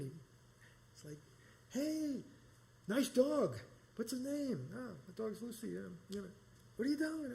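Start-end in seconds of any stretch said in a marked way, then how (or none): 1.7-7.3 bright, much higher greeting to a stranger; high friendly voice. Retold or imitated. Stranger at the rest stop greets him much higher.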